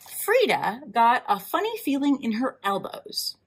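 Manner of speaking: ordinary delivery, nothing marked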